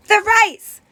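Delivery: high startled cry over the rice; alarmed realisation. A narrator's reading. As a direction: gasp it out as disaster strikes.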